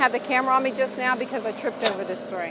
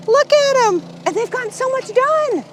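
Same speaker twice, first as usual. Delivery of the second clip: much higher, bursting with real excitement; thrilled squeal; 11 semitones higher than usual. Real, not acted. Wife shows viewers their new house site, squealing with excitement. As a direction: gush over the crew's progress like it's a dream. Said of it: "So we're beyond excited".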